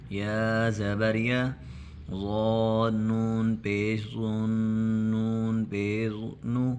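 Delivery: slow drawn-out syllable recitation; demonstration reading. Shown as a demonstration. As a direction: model the reading for the pupils. Teacher recites the spelling drill. (ہجے پڑھ کر دکھانا)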